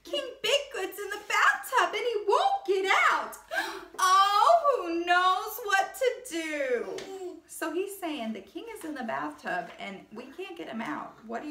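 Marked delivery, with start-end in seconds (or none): 0.0-7.4 high, wailing servant voice; panicky page. A narrator's reading. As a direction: fret and wail like a worried page. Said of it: "cried the page".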